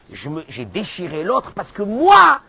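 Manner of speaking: normal talking voice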